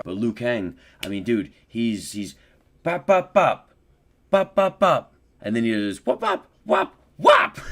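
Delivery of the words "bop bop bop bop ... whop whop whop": high loud imitated hit noises; rapid bops and whops, climbing; helicopter. Imitating rapid combo hits.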